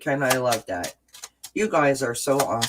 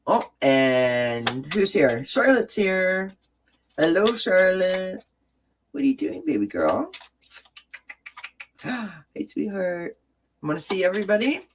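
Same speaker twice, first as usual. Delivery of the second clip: high cooing voice for a pet; 5 semitones higher than usual. Real, not acted. Greeting her pet cat.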